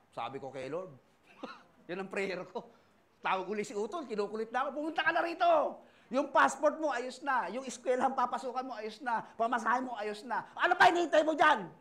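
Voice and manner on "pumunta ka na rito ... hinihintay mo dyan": louder, higher retold yell at his sibling; imitating a brother shouting down the phone. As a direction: hammer the demands like a pushy older brother. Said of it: "Pinagsisigawan ako ng utol ko".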